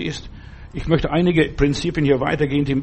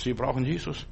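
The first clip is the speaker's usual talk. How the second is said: much softer repeat, "Jesus" lifted at the end; quiet echo; 10 dB quieter than usual. Real, not acted. Preacher to his listener, a softer echo of "Sie brauchen Jesus". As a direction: drop the voice and repeat "Jesus" gently.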